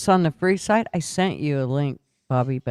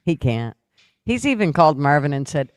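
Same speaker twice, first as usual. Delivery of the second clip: usual speaking voice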